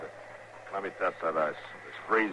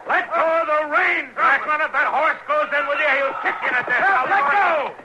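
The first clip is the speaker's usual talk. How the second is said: much higher, loud panicked shouting; urgent alarmed yell; 7 dB louder than usual. Acted. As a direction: yell as he breaks through the ice. Man breaks through the ice.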